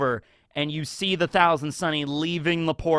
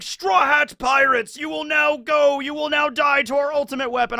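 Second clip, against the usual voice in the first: mock villain threat; 10 semitones higher, 4 dB louder than usual. Marines threatening the Straw Hats, hammed-up villain shout. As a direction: announce doom like a cartoon baddie.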